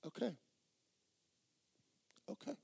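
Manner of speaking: small, high, very soft giving in; retold tearful surrender, barely voiced; broken-down surrender. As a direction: give in softly, as if broken down. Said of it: "I just broke down over my steering wheel".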